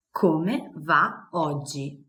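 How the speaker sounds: slow, careful Come va oggi; slow model phrase. Shown as a demonstration. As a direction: spell it out slowly for learners.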